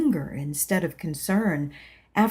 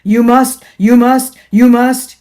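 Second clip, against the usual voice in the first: loud, higher hammering command; insistent demand; 4 semitones higher, 14 dB louder than usual. Acted. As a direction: pound each must. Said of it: "grew the feeling of alarm".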